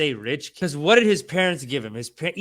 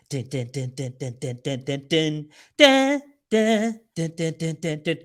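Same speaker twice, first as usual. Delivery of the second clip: ominous music hum; 2 semitones lower than usual. Retold or imitated. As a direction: hum the scary news score, deadpan. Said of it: "What's this scary music?".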